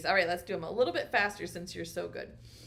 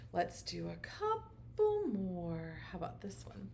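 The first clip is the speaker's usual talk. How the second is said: whisper; 5 dB quieter than usual. Acted. Teasing hush before next picture.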